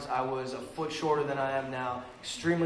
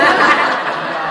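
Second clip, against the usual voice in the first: imitated laugh; 2 semitones higher, 15 dB louder than usual. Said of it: "And he's like".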